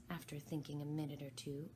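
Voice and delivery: ordinary delivery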